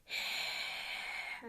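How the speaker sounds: breathy unvoiced growl, very soft; whispered growl. Shown as a demonstration. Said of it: "started doing it by whispering".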